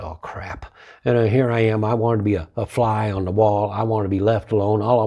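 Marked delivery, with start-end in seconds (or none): 0.0-0.7 quiet sinking groan of the words; softly dismayed aside; muttered dread. Retold or imitated. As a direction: mutter it under your breath. Dread at being called on.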